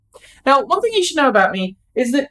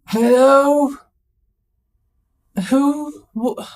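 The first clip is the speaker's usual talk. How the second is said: groggy hello; 5 semitones higher, much slower than usual. Retold or imitated. Answering phone half-asleep.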